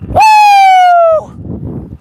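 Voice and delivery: very high, very loud whoop; loud woo. Shown as a demonstration. Showing chat how loud he'd scream on the swing. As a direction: belt out a piercing woo. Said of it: "Like this".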